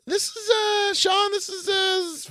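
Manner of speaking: one high held monotone note; long held bit. Pretend. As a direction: drag it out on a single high pitch. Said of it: "feels like a long bit".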